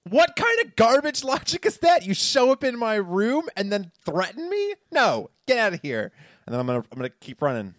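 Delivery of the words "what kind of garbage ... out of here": high, loud indignant protest; hammed-up high-pitched scolding; acted outrage. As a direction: protest indignantly at an intruder's threat. Acting out outraged reply to threat.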